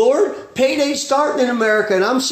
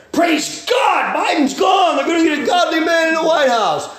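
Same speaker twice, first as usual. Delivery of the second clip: gleeful shout; 6 semitones higher than usual. Retold or imitated. Preacher voicing a glad Elijah, triumphant shout. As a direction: cheer it out like a victory rally. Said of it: "That's the attitude the man had".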